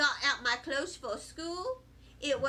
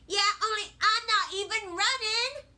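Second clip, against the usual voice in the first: put-on higher, louder kid arguing back; defiant child; 7 semitones higher, 5 dB louder than usual. A narrator's reading. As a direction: insist indignantly to Mother. Child argues back at mother.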